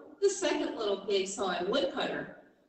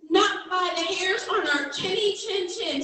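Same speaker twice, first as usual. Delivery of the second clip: high, sing-song squeak of little pigs; 9 semitones higher, 3 dB louder than usual. Acted. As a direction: squeak it like tiny frightened pigs. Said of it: "squeaked the little pigs".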